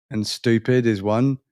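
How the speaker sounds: usual speaking voice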